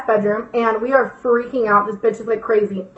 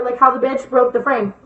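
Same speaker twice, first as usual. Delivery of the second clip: ordinary delivery, nothing marked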